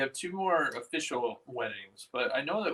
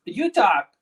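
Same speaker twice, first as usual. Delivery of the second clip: loud, high playful retort; 7 semitones higher, 12 dB louder than usual. Real, not acted. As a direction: toss it back loudly. Bouncing the turn back.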